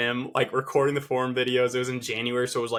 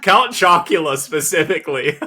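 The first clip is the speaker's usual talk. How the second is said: laughing through the words, louder; 5 semitones higher, 12 dB louder than usual. Real, not acted. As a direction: say it while cracking up. Laughing about cereal dinner.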